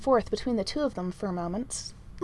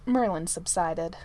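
ordinary delivery, nothing marked

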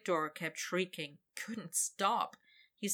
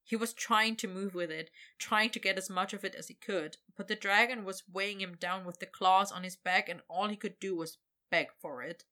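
ordinary delivery

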